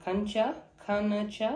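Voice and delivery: খানচা said slowly, syllable by syllable; slow pronunciation. Shown as a demonstration. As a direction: sound out খানচা for learners. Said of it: "জাস্তাশিজ". (ধীরে ধীরে খানচা)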